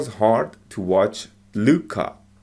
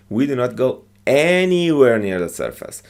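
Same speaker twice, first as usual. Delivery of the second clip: ANYWHERE louder, high and stretched out; emphatic long, loud ANYWHERE; stress on ANYWHERE; 4 semitones higher, 4 dB louder than usual. Shown as a demonstration. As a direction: swell on "anywhere", then fall away. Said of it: "we say that louder".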